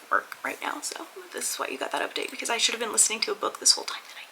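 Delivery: guilty whispered confession; soft, fast sheepish whisper. Real, not acted. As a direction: whisper it like a secret you shouldn't share. Confessing she skipped her work.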